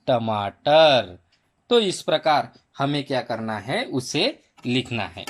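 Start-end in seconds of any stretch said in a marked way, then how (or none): 0.1-1.2 टमाटर slow and joined, last syllable drawn out; blended syllables. Shown as a demonstration. Teacher blending the sounds for pupils, टमाटर slowly drawn out. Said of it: "इसको आप मिलाएंगे सबको".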